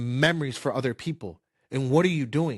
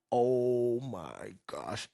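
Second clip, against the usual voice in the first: dismayed; 3 semitones lower, 6 dB quieter, much slower than usual. Retold or imitated. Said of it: "And I was like".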